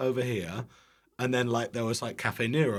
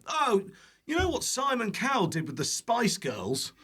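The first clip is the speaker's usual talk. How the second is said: excited pitch; 12 semitones higher than usual. Retold or imitated. Company pitching him on a call, mock excited. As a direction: imitate an overkeen caller pitching hard.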